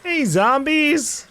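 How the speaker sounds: half-joking appeal to a crowd of zombies; mock plea to zombies. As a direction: sweet-talk the horde like you belong. Addressing zombies in the game.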